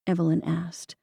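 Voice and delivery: ordinary delivery, nothing marked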